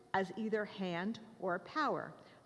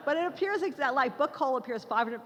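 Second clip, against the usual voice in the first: laughing; 3 semitones higher, 6 dB louder than usual. Real, not acted. Still laughing after joke.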